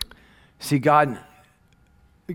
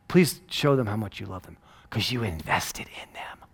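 soft unvoiced whisper; whispered prayer; 4 semitones lower, 5 dB quieter than usual. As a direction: breathe the prayer gently. Quiet prayer for the server.

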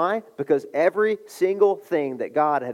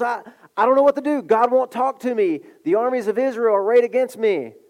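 higher, fast, rushed and desperate; panicked pleading; 8 semitones higher, 5 dB louder than usual. Acted. Saul begs the spirit of Samuel, frantic and fast. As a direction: blurt it out like a cornered king.

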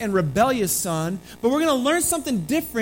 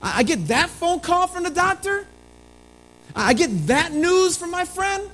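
higher, louder aggrieved cry to God; a believer protests his hard lot, higher and louder; pleading complaint; 6 semitones higher, 4 dB louder than usual. Pretend.

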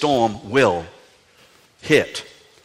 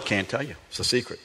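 Noah answering softer, hushed and coy; secretive Noah; 2 semitones lower, 11 dB quieter, faster than usual. Acted. Noah brushes off the neighbors, softer and teasing. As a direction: keep it a hushed little secret. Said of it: "He's pretending to be Noah".

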